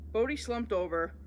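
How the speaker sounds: usual speaking voice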